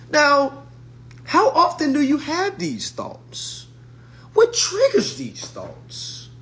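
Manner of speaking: high, drawn-out mock therapist voice. Mocking a therapist who questions a struggling client. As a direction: mimic a smug therapist probing gently. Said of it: "She's asking questions".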